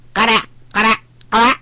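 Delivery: light duckling quack, lifted a little. A narrator's reading. Утята отвечают трактору, лёгкое кряканье. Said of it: "ответили утята".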